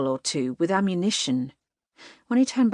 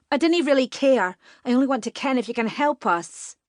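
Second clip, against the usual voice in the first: impatient dismissal; 7 semitones higher than usual. A narrator's reading. Katie dismisses the laird's title, high impatient. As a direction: brush off his title impatiently.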